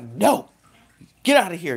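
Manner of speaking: emphatic mock shooing; acted forceful rejection. Acting out pushing loved ones away.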